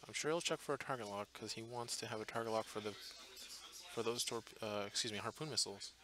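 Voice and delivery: soft, hushed play-by-play; voice kept down. Real, not acted. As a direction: commentate quietly so the players can't hear. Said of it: "keep my voice down".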